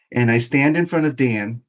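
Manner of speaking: ordinary talk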